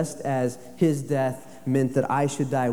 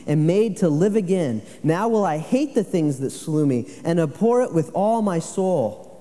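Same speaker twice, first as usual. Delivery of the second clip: louder, higher sermon reading; forceful preaching; 4 semitones higher, 4 dB louder than usual. Acted. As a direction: read Spurgeon like thundering from the pulpit.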